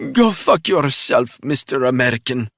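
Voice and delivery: choked spy defying her captor; defiant Russian spy. A narrator's reading. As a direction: spit the curse at him. Russian villainess held voice.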